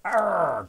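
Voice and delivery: loud rolling growl; dinosaur growl. A narrator's reading. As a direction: growl back in refusal. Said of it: "growled the super hungry dinosaur".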